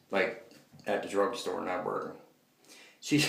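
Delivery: usual speaking voice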